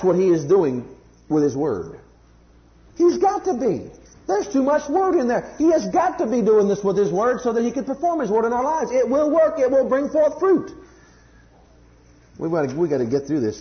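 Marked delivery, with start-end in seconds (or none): 3.0-10.7 high, loud, driving sermon voice; impassioned preaching. Acted. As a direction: preach it with full fire, pushing each line. Preaching at full force.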